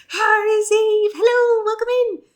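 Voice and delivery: high, loud, cheerful hello; excited greeting. Real, not acted. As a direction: call out a big welcome. Greeting a new viewer.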